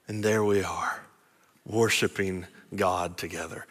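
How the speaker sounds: low, soft voice with long pauses; reverent, unhurried preacher's hush; hushed preaching. Real, not acted. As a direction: draw them quietly into the scene.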